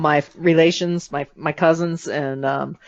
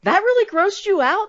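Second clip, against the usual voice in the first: mock surprise. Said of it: "and I'm like".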